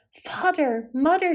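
sudden fright in her voice, but storybook-mild; crying out. A narrator's reading. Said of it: "she cried".

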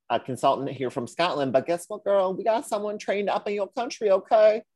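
put-on high-pitched, climbing boast to a girlfriend; sassy professor. Professor revealing a trained local to whiskey maker, sassy announcement.

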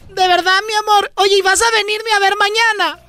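put-on high, sweet girlfriend voice; woman's voice. Man retelling a joke, voicing the girlfriend, high and sweet. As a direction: coo it up high like a smitten girlfriend. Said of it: "Y le dijo la mujer".

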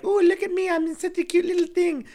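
put-on high, sweet baby-like squeak; cutesy voice. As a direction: play it up as adorable and innocent. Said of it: "His fairy, like".

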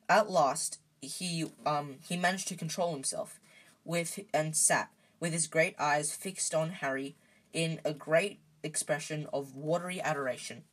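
ordinary delivery

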